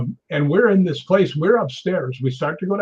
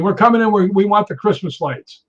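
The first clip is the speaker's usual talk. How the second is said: higher, louder imitation of pushy intruders; mock demanding; 6 semitones higher, 4 dB louder than usual. Intruders at the gate to the docent, mock demanding. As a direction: make a lightly acted loud demand.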